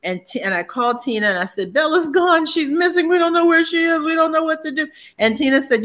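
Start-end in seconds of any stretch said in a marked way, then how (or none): 1.7-4.9 panicked call. Retold or imitated. Retelling panicked call about lost dog.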